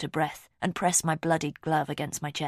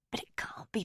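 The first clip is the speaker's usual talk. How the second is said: whispering; 9 dB quieter than usual. A narrator's reading. Desperate inner thought.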